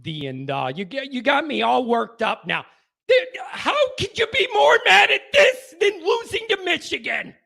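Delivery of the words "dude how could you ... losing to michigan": much higher and louder, incredulous; angry shout. Real, not acted. Host shouting at Ohio State fans about losing to Michigan. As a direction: blast the question out in disbelief.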